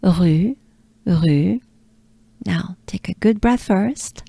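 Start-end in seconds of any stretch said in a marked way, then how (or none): 0.0-1.6 "rue" with a throaty French R and rounded U. Shown as a demonstration. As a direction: gargle the R in "rue" then round the lips. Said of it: "the French R and the U".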